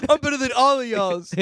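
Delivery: high, loud wailed boast; mock hysterical crying. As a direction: blubber it through laughter. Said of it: "he's, like, hysterical crying".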